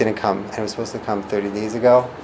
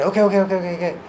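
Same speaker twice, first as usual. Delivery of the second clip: high, quick brush-off okays; hasty placating okays; 8 semitones higher, much faster than usual. Shown as a demonstration. Placating someone upset, rushing okay okay okay. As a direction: fob them off with okays.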